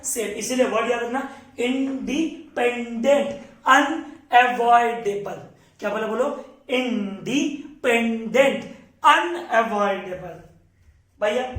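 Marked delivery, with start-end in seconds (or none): 1.6-5.4 both key words stretched syllable by syllable; slow memorising drill. Real, not acted. Teacher giving students words to remember, slow syllabic delivery. 6.7-10.4 both key words stretched syllable by syllable; slow memorising drill. Real, not acted. Teacher giving students words to remember, slow syllabic delivery.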